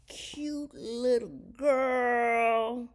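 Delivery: drawn-out, high-pitched coo. Real, not acted. Telling viewers about a baby in the store, cooing.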